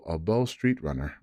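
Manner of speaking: plain reading voice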